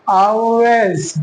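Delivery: Always drawn out as "aw-wez", L hidden; slow Always, rounded first vowel, falling at the end; dark L demo. Shown as a demonstration. Teacher models it for learners, dark L demo. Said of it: "इसको हम अदा कैसे करेंगे".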